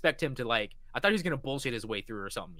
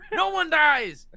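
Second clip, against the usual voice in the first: "No one dies" said high and much louder; excited shout; 14 semitones higher, 9 dB louder, much slower than usual. Real, not acted. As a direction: bellow "No one dies" the second time.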